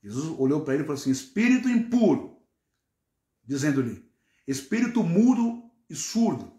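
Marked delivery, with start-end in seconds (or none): 1.1-2.4 alto e agudo, ordem firme; repreensão enérgica. Acted. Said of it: "um tom mais elevado, com um tom mais incisivo". 4.5-6.5 alto e agudo, ordem firme; repreensão enérgica. Acted. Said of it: "um tom mais elevado".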